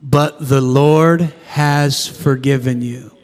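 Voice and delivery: slow, low group recitation; measured responsive reading; congregation reading. Shown as a demonstration. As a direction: read it aloud together, slowly. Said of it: "Read this with me".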